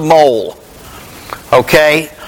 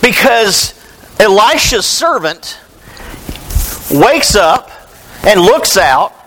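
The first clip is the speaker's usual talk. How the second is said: normal talking voice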